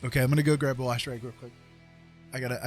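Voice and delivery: usual speaking voice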